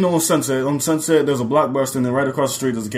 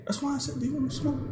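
hushed whisper; whispering on the phone; 12 semitones higher, 11 dB quieter, faster than usual. Real, not acted. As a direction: whisper so parents can't hear. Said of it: "why are you whispering?".

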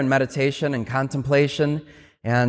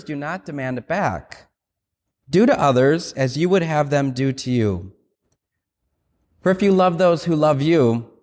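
liturgical Gospel reading. Acted. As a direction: proclaim it phrase by phrase, with pauses. Reads the Gospel at Mass.

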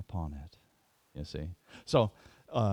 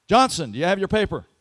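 loud, higher, fast roll call; calling out names; 10 semitones higher, 15 dB louder, faster than usual. Retold or imitated. Professor calling a student by name, loud and fast. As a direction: call the name across the hall.

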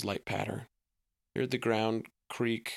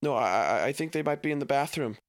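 put-on stumbling, quick, flustered kid; a scared boy stalling; lightly acted nervous stammer; 5 semitones higher, 5 dB louder, faster than usual. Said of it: "you're acting all awful nervous".

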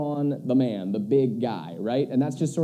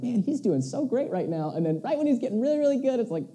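mock admiring friend; 10 semitones higher, faster than usual.

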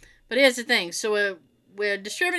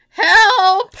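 high, loud call for help; 7 semitones higher, 10 dB louder than usual. Retold or imitated. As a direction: call out stuck in the mud. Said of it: "I look at him and I go".

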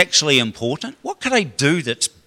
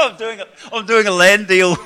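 laughing through the words at himself; embarrassed laugh while talking; 7 semitones higher, 6 dB louder than usual. Real, not acted. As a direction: caught out, laughing.

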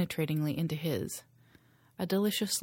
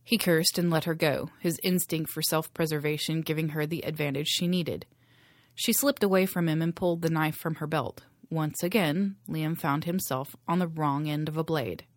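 usual speaking voice

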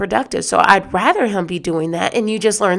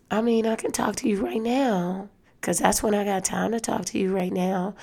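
mom on the phone; 8 dB quieter than usual. Retold or imitated. Mom brushing off the caller, softly. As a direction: murmur it like a private call she doesn't want overheard. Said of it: "she had conversation that was like".

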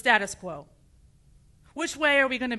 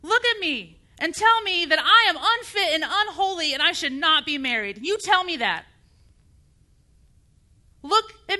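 heated face-to-face challenge, loud at first; indignant demand from the pulpit; angry challenge; 2 semitones higher, 3 dB louder than usual. Acted. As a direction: confront a superior with righteous anger, then ease slightly. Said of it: "Is my anger inappropriate?".

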